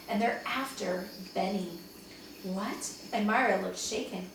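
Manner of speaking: plain reading voice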